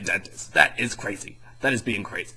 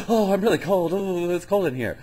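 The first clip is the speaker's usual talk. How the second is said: put-on high, louder whiny little girl complaining of the cold; 8 semitones higher, 3 dB louder than usual. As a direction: whine like a shivering kid. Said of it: "Karen's all like".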